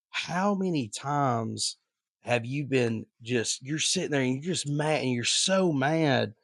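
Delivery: ordinary talk, nothing marked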